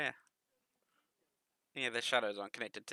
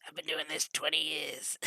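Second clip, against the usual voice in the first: higher, raspy heavy-smoker voice; 6 semitones higher, 3 dB louder than usual. Acted. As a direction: put on a smoker's rasp, pitched up. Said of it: "Like he's been smoking".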